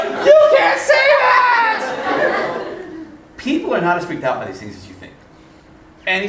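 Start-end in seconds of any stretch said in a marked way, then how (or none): 0.0-2.6 very high, slow, drawn-out yell; mock horrified shriek. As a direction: shriek in hammed-up horror, stretching each word. Will acted out, horrified by embarrassment.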